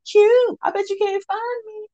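high, drawn-out mimicked taunt; teasing woman's call. Woman hiding with her man teases him, high playful taunt.

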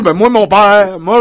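ordinary talk

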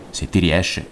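ordinary delivery

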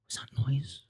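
soft, hushed question; hushed passerby. Retold or imitated. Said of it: "people are walking through the streets".

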